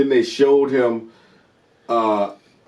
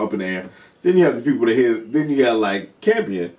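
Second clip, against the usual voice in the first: normal talking voice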